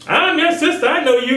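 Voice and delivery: high, put-on voice of a pushy stranger; high mock voice of a stranger. Mocking voice of a stranger.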